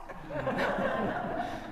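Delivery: high burst of laughter; real laugh; amused laugh. Real, not acted. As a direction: laugh at the irony of it. Laughs at his story's punchline.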